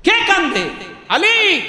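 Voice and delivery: high, startled question and call; urgent calling out. Acted. Prophet hears crying and summons Ali, urgent calling out. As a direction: call out on a sharp high pitch.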